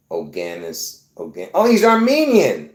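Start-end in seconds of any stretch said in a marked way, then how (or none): none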